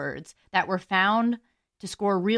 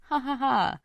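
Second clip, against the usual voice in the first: deliberate mock "ha ha ha"; fake laugh; 5 semitones higher than usual. Vlogger demonstrating a giggle, fake laugh. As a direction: force out a higher, spelt-out laugh.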